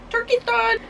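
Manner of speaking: high playful sing-song. Acted. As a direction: lilt it up like a tune.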